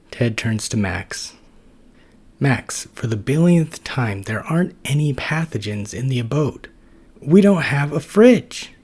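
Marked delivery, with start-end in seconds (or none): 2.4-8.7 higher, louder, slowing on the last words; fed up, repeating himself; exasperated Ted. Acted.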